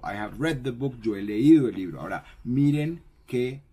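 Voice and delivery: normal talking voice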